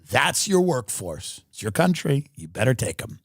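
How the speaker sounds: ordinary delivery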